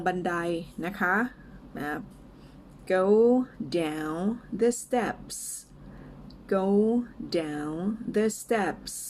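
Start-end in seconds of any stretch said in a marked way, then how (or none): 2.9-8.9 word-by-word model for repeating, with pauses; slow and clear. Shown as a demonstration. English teacher models a phrase for learners, slow and clear. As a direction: say it slowly, each word apart. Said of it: "ช้าๆ ชัดๆ ไปก่อนนะคะ".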